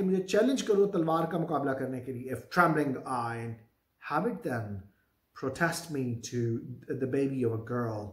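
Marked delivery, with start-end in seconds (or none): 2.3-8.1 slow, low Shakespeare read-aloud; measured, low-pitched recitation; play reading. Acted. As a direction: read the verse out low and deliberately. Reads Macbeth's lines.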